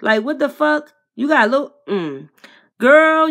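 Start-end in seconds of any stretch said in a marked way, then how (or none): 2.8-3.3 loud exasperated call. Real, not acted. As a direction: call her out loud and high. Scolding Queen directly.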